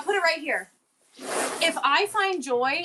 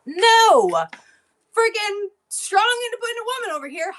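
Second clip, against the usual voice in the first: defiant sarcasm; 8 semitones higher, 5 dB louder, slower than usual. Real, not acted. Defiant self-mocking outburst.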